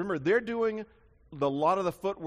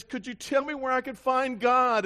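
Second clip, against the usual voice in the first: higher, slow, pleading voice; mock sobbing stranger; 9 semitones higher, 6 dB louder than usual. Sobbing stranger on the bus begging to find God, high and slow. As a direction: play the weeping stranger's plea, high and slow. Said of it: "he starts sobbing".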